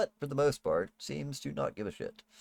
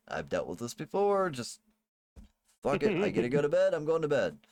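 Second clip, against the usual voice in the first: exasperated; 5 semitones higher than usual. Acted. Maid's inner thoughts voiced by GM.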